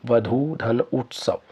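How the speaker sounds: slow spelling out. Shown as a demonstration. Teacher spells out वधू धन उत्सव slowly for students. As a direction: say वधू धन उत्सव slowly, each part apart. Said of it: "वधू शब्द ऐसे लिखा जाएगा".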